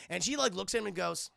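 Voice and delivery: ordinary talk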